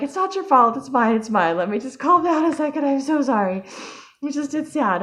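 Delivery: high-pitched replay of a flustered apology; retold flustered reassurance. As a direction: act out trying to calm yourself while apologizing. Acts out her flustered reassurance of friend.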